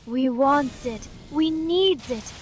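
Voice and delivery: slow, hissing cave creature; Gollum voice. Acted. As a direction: whine it like a greedy creature. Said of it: "can you have Gollum and Gollum".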